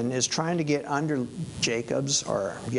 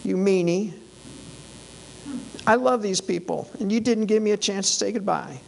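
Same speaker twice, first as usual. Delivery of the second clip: whining, higher and hurt; pity-party whine; 5 semitones higher, faster than usual. Retold or imitated. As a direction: play the wounded victim for the crowd. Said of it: "throwing out the pity party".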